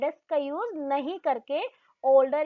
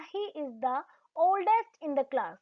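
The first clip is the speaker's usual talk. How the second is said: slow example read; much slower than usual. Shown as a demonstration. Teacher reads the example sentence to students slowly.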